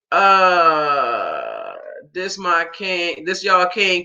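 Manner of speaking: slow, mock-weepy drawl echoing a commenter. Mocking a viewer's comment with a slow, mock-weepy drawl. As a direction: sneer it back like a quote she didn't believe. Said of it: "Come on, shaming much".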